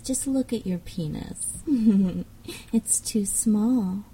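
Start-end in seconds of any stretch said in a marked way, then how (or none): 1.7-2.2 mocking laugh. Mocking listener's small size.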